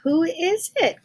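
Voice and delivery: put-on high, slow old-lady call; Granny calling to the door, high and slow. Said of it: "called out Granny".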